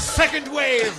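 high, loud battle shout; shouting orders over battle. Acted. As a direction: yell the order across the field. Joins battle scene.

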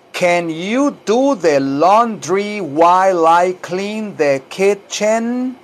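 slow, stretched-out English for repeating; slow teaching model. Shown as a demonstration. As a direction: draw out each word clearly for learners. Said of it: "tal como lo oye que yo lo digo". (modelo lento, claro y alargado)

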